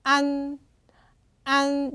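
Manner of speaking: 안 held on a final n; pronunciation demo. Shown as a demonstration. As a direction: model 안 alone, sustaining the n. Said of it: "이렇게 읽어주시면 돼요".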